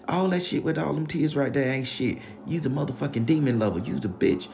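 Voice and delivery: high, loud scolding grandma; grandma throwing him out. Retold or imitated. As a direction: chase him out of the house.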